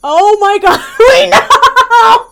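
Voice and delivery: very loud, high shriek of laughter; exasperated laughing yell. Real, not acted. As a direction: scream it out, cracking up. Exasperated at laws shown again.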